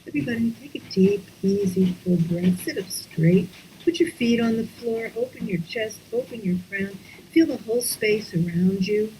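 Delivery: gentle meditation lead; guided breathing. Acted. Leads a breathing exercise.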